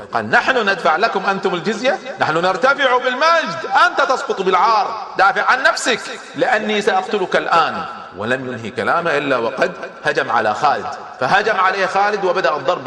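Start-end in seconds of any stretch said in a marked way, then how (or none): 0.3-7.8 higher, louder indignant outburst; angry outburst with a laugh in it; angry retort. Retold or imitated. The Roman commander furious at the demand for tribute. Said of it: "فغضب أدادير".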